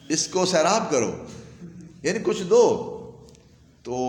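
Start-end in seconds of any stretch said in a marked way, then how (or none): none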